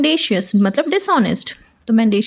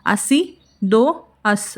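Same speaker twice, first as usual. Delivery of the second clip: अस्सी दो अस drawn out very slowly, each word apart; slow, deliberate mnemonic demo; much slower than usual. Shown as a demonstration. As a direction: spell out अस्सी दो अस slowly like a memory trick. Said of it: "तो अस्सी डू अस".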